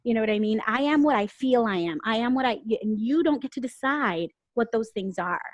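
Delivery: ordinary talk, nothing marked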